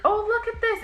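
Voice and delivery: high, loud mock-sweet exclamation; sister's fake distraction. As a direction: mimic her luring the kid off. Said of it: "She'd be like".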